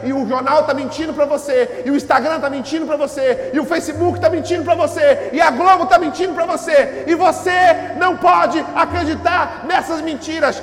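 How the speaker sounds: loud, high-pitched sermon climax; full-force preaching. Real, not acted. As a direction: proclaim it to the whole church, loud and high. (pregação inflamada, em voz alta)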